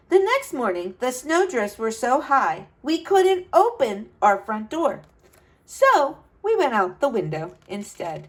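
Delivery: put-on bright, lively read-aloud to kids; storybook narration. A narrator's reading. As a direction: tell it like a storyteller for children. Reads picture book aloud.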